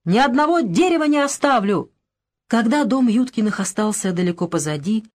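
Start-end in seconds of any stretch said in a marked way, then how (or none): 0.1-1.9 high-pitched furious cries; yelled threats. A narrator's reading. Евдоким howls from the house after the crowd, yelling. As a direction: wail out threats in a rage. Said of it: "как он выкрикивал".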